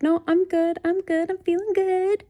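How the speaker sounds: mock-breezy insistence in a high voice; chirpy refusal. Acts out herself turning down food.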